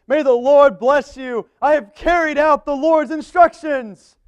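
high, slow, overeager Saul; Saul voiced high.

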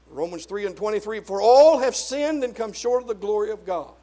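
loud scripture-quoting pulpit voice, punched key words; forceful preaching. Real, not acted. Preacher quoting Romans on sin to congregation, forceful preaching. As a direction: proclaim it with heavy emphasis.

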